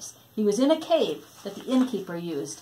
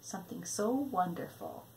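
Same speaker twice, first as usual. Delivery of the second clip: hushed; 4 semitones lower, 13 dB quieter than usual. A narrator's reading. Hushed suspense, very soft.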